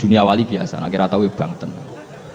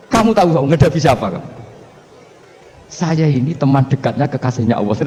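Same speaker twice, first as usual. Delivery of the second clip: raised, higher challenge to the grave angels; defiant retort; 5 semitones higher than usual. Acted. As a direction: throw it back at them, bold. Umar snaps back at Munkar Nakir.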